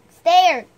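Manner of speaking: Stared, loud, with no t sound in the middle; stared said clearly. Shown as a demonstration. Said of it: "I always get started and stared".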